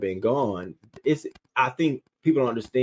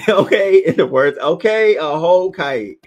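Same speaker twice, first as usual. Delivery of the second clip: much higher, loud laughing okay; hyped agreement; 9 semitones higher, 10 dB louder than usual. Real, not acted. Hyping up a chat comment about sending a kite, loud and laughing. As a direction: cheer the comment on.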